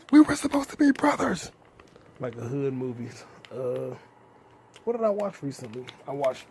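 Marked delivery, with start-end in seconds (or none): 0.1-1.5 much higher, louder betrayed wail; mock anguish. Vlogger wailing at the unsubscriber, hammed-up betrayed cry. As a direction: ham up the heartbreak.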